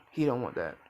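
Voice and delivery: ordinary delivery, nothing marked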